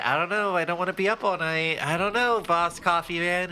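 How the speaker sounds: arguing with the can, in a high whine; reluctant whine. Retold or imitated. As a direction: whine your doubts up high. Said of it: "I'm saying".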